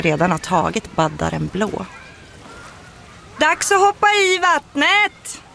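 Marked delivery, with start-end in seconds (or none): 3.4-5.4 high, slow, loud call to the swimmers. A narrator's reading. Swim teacher calls the children into the water, loud and slow. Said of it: "ropar simfröken".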